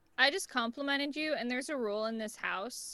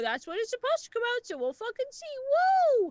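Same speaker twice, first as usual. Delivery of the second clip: higher, excited tease; giddy teasing; 10 semitones higher, 4 dB louder than usual. Real, not acted. Teasing fans about upcoming roles, giddy and high. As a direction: sing it out like a secret you can't keep.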